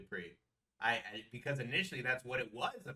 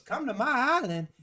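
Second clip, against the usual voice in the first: put-on New Orleans accent; 13 semitones higher, 12 dB louder than usual. She lures someone to her island, mock New Orleans accent. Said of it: "she got an accent".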